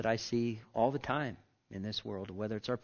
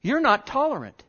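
high, louder accusing tone; imitating a critic's jab; mock accuser; 12 semitones higher, 8 dB louder than usual. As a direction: fling the accusation back. Voicing an accuser.

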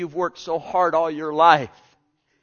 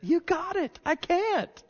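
much higher, ringing repeat; high emphatic reply; slower than usual. Acted. Preacher answering the skeptic, high and emphatic. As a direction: lift it up high.

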